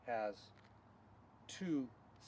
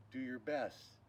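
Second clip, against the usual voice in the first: ordinary talk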